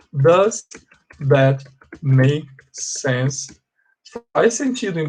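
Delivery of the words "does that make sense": very slow, each word drawn out; slow, spelled-out question. Shown as a demonstration. Teacher repeating the question slowly for students, drawn out. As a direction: say it slowly as if writing it down. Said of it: "eu vou até escrever, ó".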